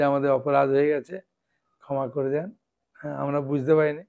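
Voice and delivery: understated, humble begging, voice dropping away; retold apology. Villagers beg forgiveness for their offence, quiet retold plea. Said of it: "এসে তারা ক্ষমা চাইছে".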